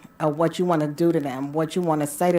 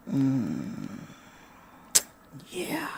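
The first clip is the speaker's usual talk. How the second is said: soft moan; 5 semitones lower, 11 dB quieter than usual. Shown as a demonstration. Showing listeners what a moan is, softly moaned. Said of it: "I'm talking about a moan".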